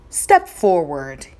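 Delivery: low, firm command; king commanding the boy. Acted. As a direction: order him forward. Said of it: "he commanded".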